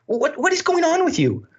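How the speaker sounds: high-pitched incredulous challenge; hammed-up disbelief; mock exasperation. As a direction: act baffled by a heckler, voice jumping up. Acted challenge to an audience member.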